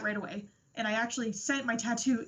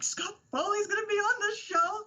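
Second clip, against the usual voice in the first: thrilled disbelief; 9 semitones higher than usual. Retold or imitated. Guest retells surprise guest.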